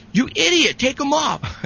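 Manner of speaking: mock him like a buddy laughing at you; mocking taunt. Buddy on the phone laughing at his friend, high mocking taunt. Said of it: "And he goes".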